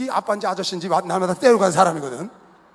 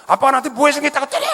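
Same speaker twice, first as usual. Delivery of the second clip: higher and louder, hurt and furious; teen's angry yell; 9 semitones higher, 7 dB louder than usual. Retold or imitated. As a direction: throw it back at your dad. Teen son yelling at father.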